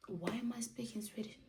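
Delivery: quick whispered aside. Real, not acted. Catching herself mid-story, whispered aside. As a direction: mutter it to yourself. Aside about her own speech.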